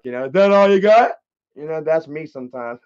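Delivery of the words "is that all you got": loud, defiant taunting yell; Randy. Retold or imitated. Beaten fighter taunting whoever whooped him, defiant shout. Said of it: "Kinda like Randy from damn South Park".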